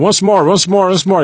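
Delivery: high, insistent English-speaking referee; mock American ref demanding a repeat. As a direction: call it out like a foreign referee pressing her. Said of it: "o juiz veio atrás dela e começou".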